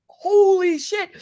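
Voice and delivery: high, loud, drawn-out shock; stunned exclamation. Retold or imitated. Driver seeing two cops fighting a big dude, stunned. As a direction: let it burst out slowly in disbelief.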